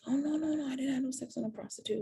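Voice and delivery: high, fast mocking denial as the boyfriend. Mimicking her fiancé denying the dream, high and fast. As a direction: ham up his hurried, defensive no no no. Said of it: "he was like".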